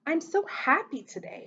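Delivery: mild storybook excitement; excited. A narrator's reading. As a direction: read it with a lift of excitement. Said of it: "Hudson said excitedly".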